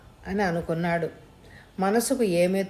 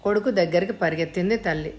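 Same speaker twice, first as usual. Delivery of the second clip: usual speaking voice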